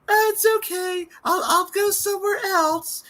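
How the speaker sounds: high, squeaky put-on voice; mocking high-pitched impression of a voice-acting hopeful; Mason. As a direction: squeal it like a cartoon character. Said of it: "Mason's like".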